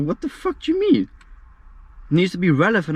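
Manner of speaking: high, loud indignant outburst; retold outrage. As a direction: blurt it out in disbelief. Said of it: "I'm like".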